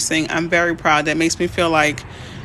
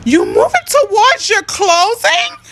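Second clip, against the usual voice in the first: much higher, louder and slow, thrilled; excited squeal; 7 dB louder, slower than usual. Real, not acted. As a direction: stretch it out, delighted for them. Excited for viewer nearing home closing.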